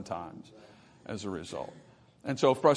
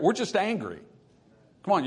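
normal talking voice

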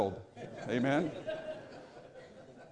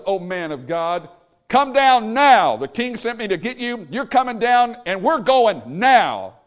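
slow, loud and higher on "come down now"; demanding shout; 5 semitones higher, 5 dB louder, slower than usual. Retold or imitated. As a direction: lay down the order with force. Captain demanding Elijah come.

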